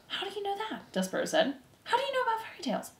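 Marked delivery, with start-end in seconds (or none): 0.1-0.9 very high, soft little voice; hushed astonishment. A narrator's reading. Despereaux to the threadmaster, hushed and amazed. 1.8-2.9 fast, very high, soft squeak; hushed astonishment. A narrator's reading. Despereaux asking about fairy tales, hushed and amazed.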